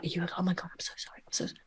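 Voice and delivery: hushed, whispered apology; mortified whisper. Retold or imitated. Head teacher apologising to the visiting speaker, in a whisper.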